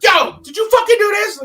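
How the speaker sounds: high, loud excited burst; shouted, high-pitched accusing question; acted yelling. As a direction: yell it like an urgent demand. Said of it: "Why are you yelling?".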